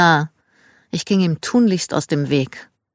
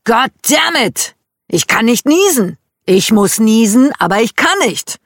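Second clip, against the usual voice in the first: imitating dad's exasperated whine, higher and louder; frustrated griping; 6 semitones higher, 6 dB louder than usual. Dad grumbling that he can't sneeze, mock exasperation.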